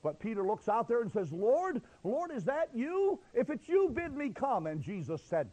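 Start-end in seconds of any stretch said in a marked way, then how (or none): 1.3-4.7 high, urgent plea; calling out. Acted. Peter calling to the Lord from the boat, high and pleading. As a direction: call out high across the water.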